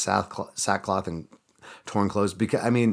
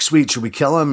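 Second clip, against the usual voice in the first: much higher and louder, gleeful; eager soldiers; 8 semitones higher, 8 dB louder than usual. Retold or imitated. Israelite soldiers eager to kill captured raiders, gleeful. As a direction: jump at the chance to kill. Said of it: "And they're like".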